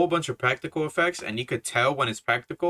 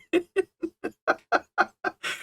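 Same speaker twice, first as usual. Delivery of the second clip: a sudden high squeal of laughter. Real, not acted. Reviewer recalling a funny scene, squealing with laughter.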